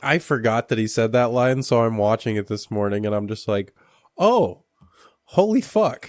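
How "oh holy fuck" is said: high, loud retold gasp of disbelief; shocked reaction. Viewer hearing that line this morning, shocked. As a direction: pitch jumps way up in replayed shock.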